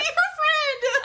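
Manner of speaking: very high, drawn-out squeal; excited pleading. Acted. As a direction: plead in a slow, laughing squeal. Urging a character to befriend her.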